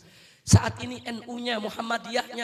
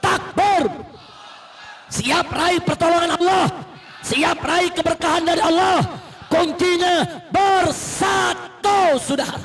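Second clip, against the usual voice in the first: high, loud call to the crowd; rally shout; 6 semitones higher, 7 dB louder, slower than usual. Real, not acted. Preacher calling the crowd to takbir, shouting. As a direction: rouse them to shout back.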